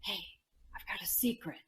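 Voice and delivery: put-on whispered secret to the kids; hushed conspiratorial whisper. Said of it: "coming close and saying".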